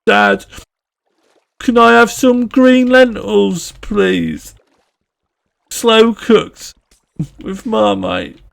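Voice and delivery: put-on sing-song Brummie kid, high then falling; Brummie accent. Child asking dad for lentils, high Brummie accent. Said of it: "doing a Brummie accent asking for".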